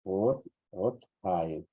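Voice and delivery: "Ot, otjai" slow, first syllable set apart; slow syllable demo of "otjai"; slow split word. Shown as a demonstration. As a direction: break "otjai" into slow parts for students. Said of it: "Pero también con minúsculas".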